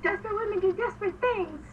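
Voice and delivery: imitating a cheeky retort; mock saucy comeback. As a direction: act out a playful bicker. Teasing comeback voiced for a character.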